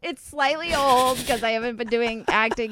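laughs through the words; embarrassed laugh while talking. Real, not acted. Laughs at her acting reel.

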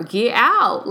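firm command. Retold or imitated. Rider ordering rude passenger out, firm and loud. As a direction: put your foot down with a stranger. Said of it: "until I told her".

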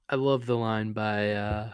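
usual speaking voice

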